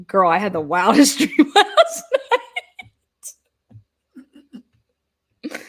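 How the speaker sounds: laughing retold gossip. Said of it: "I'm like".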